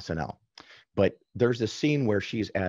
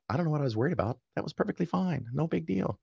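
lightly voiced wife, higher and softer; relieved wife; 7 semitones higher, 4 dB quieter, faster than usual. Wife after hearing the recording, shrugging it off higher and softer. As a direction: mildly imitate the wife shrugging it off. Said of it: "she goes".

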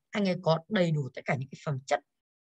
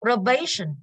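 "Probation" with a strong "ba"; stress on second syllable; 3 semitones higher, 4 dB louder, slower than usual. Shown as a demonstration. Teacher checking the answer with the class, "Probation" stressed on "ba". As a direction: lean hard on "ba" in "Probation". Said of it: "âm hai, đúng không ạ?".

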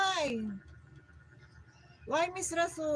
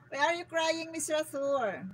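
ordinary talk

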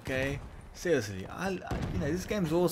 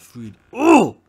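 frustrated outcry; 4 semitones higher, 12 dB louder than usual. Real, not acted. Final rage outburst.

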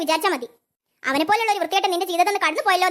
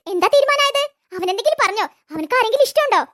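excited curiosity; 7 semitones higher than usual. Acted. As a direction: burst out wanting to know. Eager questions about brother.

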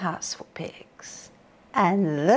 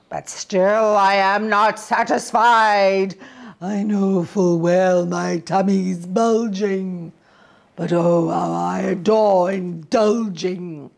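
wolf; 4 dB louder than usual. A narrator's reading. Wolf speaks.

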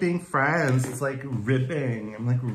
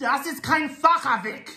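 very high, hammed-up German order; Nazi-like bark; 14 semitones higher, 4 dB louder than usual. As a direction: overdo it as a German villain. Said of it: "We are not all Nazis".

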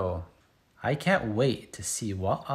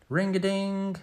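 bell ringing; 7 semitones higher than usual. Pretend. School bell in story.

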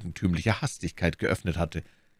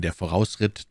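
usual speaking voice

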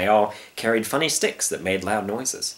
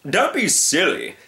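high, slow dismissal; pooh-pooh the lion's idea; pompous storybook hunter; scoffing hunter; 9 semitones higher than usual. Acted.

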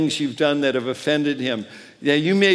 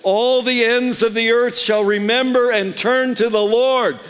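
higher and louder, ringing proclamation; triumphant preacher's cry; proclaimed; 7 semitones higher, 3 dB louder than usual. Shown as a demonstration. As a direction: lift the verse up like a declaration to the crowd. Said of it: "And here's what it says".

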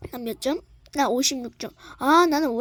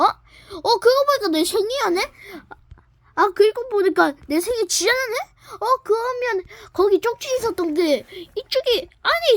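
higher pitched excited discovery; surprised realization; 6 semitones higher than usual. Acted. Host realizing it's their birthday, surprised. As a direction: realize it suddenly, voice jumping up.

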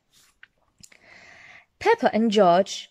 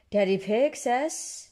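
ordinary delivery

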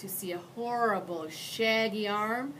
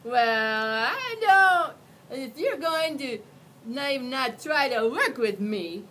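louder, higher crying whine; whining; 5 semitones higher, 10 dB louder, slower than usual. Acted. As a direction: whine like a hurt big monster. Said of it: "Mac whined".

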